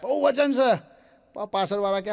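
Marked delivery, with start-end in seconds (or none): 0.0-0.8 higher, strained pleading under a heavy load; mock groaning bearer begging to go slower; straining pallbearer.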